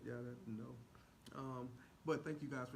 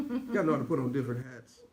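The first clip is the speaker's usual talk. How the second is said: louder, fast, proud declaration; mock proud boast; 4 semitones lower, 11 dB louder, faster than usual. [name] bragging to viewers about his many hats, loud and quick. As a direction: announce it like a boast. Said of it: "a different [name]".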